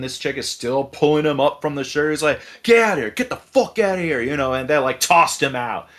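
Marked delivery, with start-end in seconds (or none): 2.6-4.2 imitated ordering out. Fed-up woman dragging the drunk out, mock angry order. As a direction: act out throwing a drunk out. Retold throwing him out.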